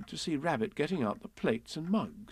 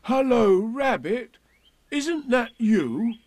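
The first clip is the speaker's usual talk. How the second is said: much higher, louder call; 11 semitones higher, 6 dB louder, much slower than usual. Acted. Pooh calls to Rabbit inside the hole, loud high call. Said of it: "put his head back in the hole and said".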